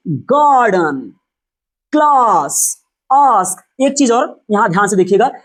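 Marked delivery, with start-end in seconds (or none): 0.1-1.1 गार्डन drawn out very slowly, long gaa; slow model word. Shown as a demonstration. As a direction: stretch गार्डन for students. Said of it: "अब देखिए इधर देखिए".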